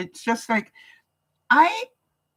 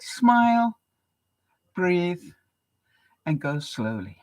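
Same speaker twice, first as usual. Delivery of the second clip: calm quote; 2 semitones lower, slower than usual. Retold or imitated. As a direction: gently quote the monk's calm advice. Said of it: "and he said".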